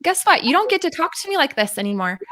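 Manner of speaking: a cheeky stand-up to the abuser; defiant. Retold or imitated. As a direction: tell him off, half laughing. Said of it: "I was like".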